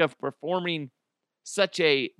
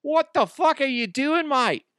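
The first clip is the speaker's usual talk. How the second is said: high, loud Australian firefighter; hammed-up Aussie tradie accent; Australian bloke shouting at the patient; mock Australian accent; 8 semitones higher, 3 dB louder than usual. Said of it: "they're in Australia".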